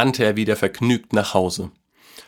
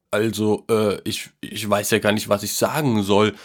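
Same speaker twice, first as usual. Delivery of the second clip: halting, lost for words; stammering. A narrator's reading. Grandpa reacts to the invitation, moved and stammering. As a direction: stumble haltingly through the words. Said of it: "stammelte Opa Werlin".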